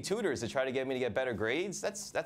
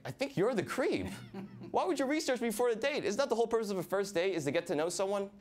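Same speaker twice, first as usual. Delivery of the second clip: indignant; 9 semitones higher than usual. Retold or imitated. His indignant reply to date.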